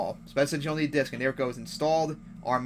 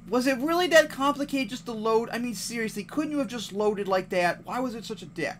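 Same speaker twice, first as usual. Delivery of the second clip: exasperated; 8 semitones higher than usual. Real, not acted. Ranting at slow loading.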